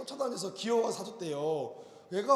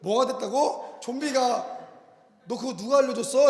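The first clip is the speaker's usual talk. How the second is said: shocked demand; 3 semitones higher, 8 dB louder than usual. Retold or imitated. Father demands who taught this.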